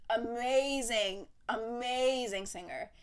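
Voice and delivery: AMAZING drawn out long on the middle syllable; slow, savored AMAZING; emphatic praise. Real, not acted. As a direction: stretch out AMAZING slowly each time. Gushing praise of [name].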